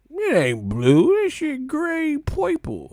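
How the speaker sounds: high, squeaky mock whine; hammed-up contrarian squeal; contrarian mockery. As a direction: mimic a nitpicking hater. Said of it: "I could be like".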